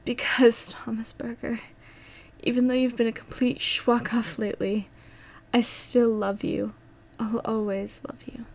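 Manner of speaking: high, whispery, tender voice; dying confession. Acted. Dying Anna tells Thomas she loves him, weak whisper. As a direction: confess it faintly as strength fades.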